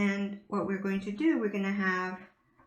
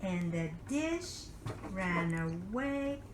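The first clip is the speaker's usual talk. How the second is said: put-on reading to a toddler; 4 dB quieter, slower than usual.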